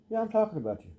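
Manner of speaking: high teasing doggy voice; high pet talk. Real, not acted. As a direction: tease the dog fondly. Teasing the dog.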